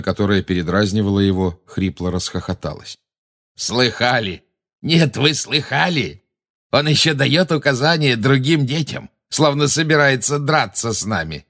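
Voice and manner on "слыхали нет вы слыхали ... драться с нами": high, taunting mockery; jeering villainess. A narrator's reading. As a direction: crow it to the gang, pitched up. Bellatrix jeering after a hoarse laugh. (издевательски, высоким голосом)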